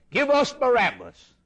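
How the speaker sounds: high, loud mob shout; crowd's demand. Acted. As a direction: call it out like a baying crowd. Voicing the crowd at Pilate's hall.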